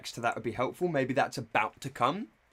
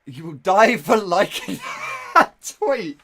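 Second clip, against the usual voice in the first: loud, higher, cracking up mid-line; laughing shout; 7 semitones higher, 10 dB louder, slower than usual. Real, not acted. Streamer repeating the boyfriend's threat, loud and cracking up. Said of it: "I'm laughing at how you can talk".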